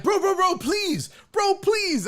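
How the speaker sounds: high, urgent stacked "bro"; mock pleading. Laughing at the clip, mimics the scared man's frantic mock pleading. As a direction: plead fast like you're panicking. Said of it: "This is what I love is he's like".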